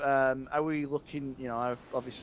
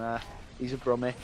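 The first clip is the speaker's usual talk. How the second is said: usual speaking voice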